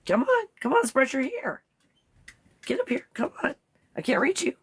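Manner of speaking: high, sing-song coaxing. Real, not acted. Coaxing the dog to brush.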